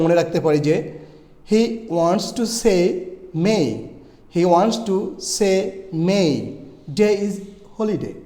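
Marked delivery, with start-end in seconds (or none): 1.5-8.2 word by word, pausing before "May"; very slow, with long ay in "say", "May", "day"; slow practice sentence. Shown as a demonstration. Teacher gives a practice sentence, slow and separated. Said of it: "আরও কিছু practice এখানে করা যেতে পারে".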